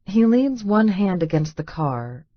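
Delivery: ordinary delivery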